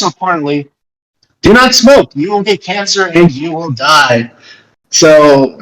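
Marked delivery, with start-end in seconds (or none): 1.4-4.3 emphatic warning. Real, not acted. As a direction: bark the warning at the audience. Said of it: "urgent warning to listeners".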